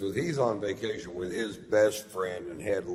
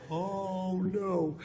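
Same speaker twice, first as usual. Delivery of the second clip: high, gushing Oh no; mock overwhelmed; 12 semitones higher than usual. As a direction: squeal it out, overcome. Moved by her singing.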